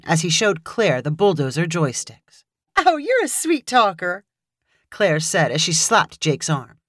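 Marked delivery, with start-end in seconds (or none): 2.8-4.2 higher, flirty and teasing; playful flirting. A narrator's reading. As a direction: tease him playfully. Claire flirts, slaps arm.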